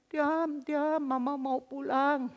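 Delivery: retold mother's drawn-out plea; plaintive calling out. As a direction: call through a door, stretching the words slowly. Mother calls son through the door.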